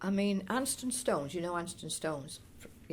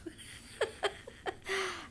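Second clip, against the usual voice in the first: long, high chuckling laugh; fond laugh; 12 semitones higher, 3 dB quieter than usual. Real, not acted. Recalling family Christmases at home, fond laugh. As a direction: run of high, amused chuckles.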